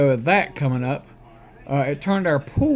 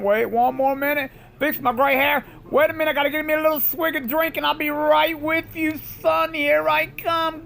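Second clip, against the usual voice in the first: playful; 9 semitones higher than usual. Acted. Playful stalling, calling out to son.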